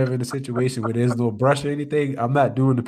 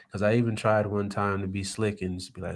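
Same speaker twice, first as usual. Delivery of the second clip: ordinary talk, nothing marked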